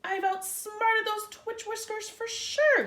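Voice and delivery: high, loud and slow boast; exclaiming. A narrator's reading. McGreely boasting of beating the bunnies, high exclaim. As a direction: crow it out in triumph. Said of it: "he exclaimed".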